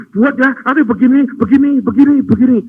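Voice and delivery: mock a God who over-explains; long-winded instructions. Preacher mocks a wordy God, loud and rattling. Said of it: "Tanpa gini".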